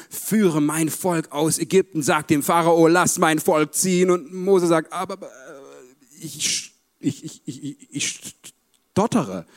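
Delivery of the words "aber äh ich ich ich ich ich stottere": halting, stuttering Moses; mock stammer. Moses stammering his excuse to God. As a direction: stumble over ich like Moses.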